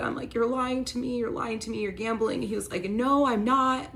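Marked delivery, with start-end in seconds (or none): 0.3-2.4 high, pained accusation; retelling her tearful accusation; accusing. Retold or imitated. To her partner, a raised accusing cry. Confronting lying partner in kitchen. 2.9-3.9 high, loud, slow denial; mock his stubborn denial; denying. Partner denying the gambling, loud slow denial. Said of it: "like, still lying".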